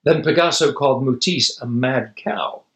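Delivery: ordinary delivery, nothing marked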